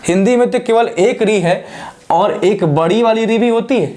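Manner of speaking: normal talking voice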